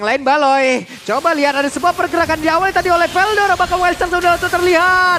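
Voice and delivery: rising hype play-by-play call; fighting game announcer. Acted. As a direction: build it up to a big kill shout. (komentator esports yang makin heboh)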